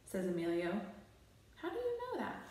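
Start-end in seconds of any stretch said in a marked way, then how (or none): 1.6-2.4 high, startled question; suspicious squeak; surprised. A narrator's reading.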